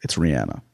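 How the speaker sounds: low, soft Rihanna as ree-ANN-uh; corrected pronunciation. Shown as a demonstration. Host telling folks to just accept it, low ree-ANN-uh. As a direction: quietly insist on the ANN in RIHANNA. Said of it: "Just accept it".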